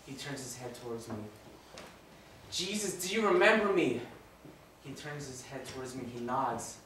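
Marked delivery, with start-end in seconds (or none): 2.5-4.0 higher, louder imploring question; pleading call. Acted. Dying robber asking Jesus to remember him, pleading loudly. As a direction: call across, begging to be remembered.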